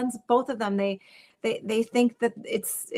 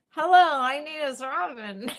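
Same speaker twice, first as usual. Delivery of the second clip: talking with mouth closed; ventriloquist voice; 4 semitones higher than usual. Shown as a demonstration. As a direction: speak without moving your lips. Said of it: "talk without moving your mouth".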